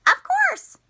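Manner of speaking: high, loud squeaky reply; puppet reply. Acted. Puppet Miss Moo answers the teacher, high and loud. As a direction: chirp it eagerly as the puppet.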